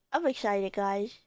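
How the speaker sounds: usual speaking voice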